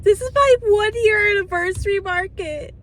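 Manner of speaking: holding back happy tears; emotional, almost crying; on the verge of tears. Real, not acted. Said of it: "I literally feel like crying".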